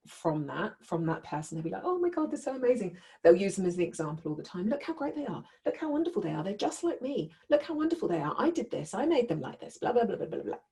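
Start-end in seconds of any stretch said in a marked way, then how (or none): 1.8-3.0 high, fast mock adoration; mock gushing. Gushing narcissist praising golden child. 4.7-10.6 high, sing-song parody of doting praise; mock boastful gushing. Imitates narcissist boasting about golden child.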